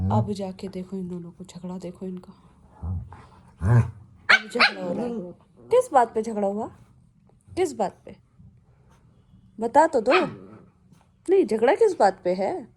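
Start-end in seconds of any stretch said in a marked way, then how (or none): 1.4-2.3 softer whispered aside to the viewer. Real, not acted. Owner filming her dogs fighting, whispered. 4.3-12.7 playful questioning of dogs. Real, not acted.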